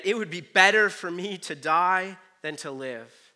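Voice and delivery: exasperated outburst, read aloud; angry despair. A narrator's reading. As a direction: read Jonah's complaint forcefully. Jonah's angry quote in scripture.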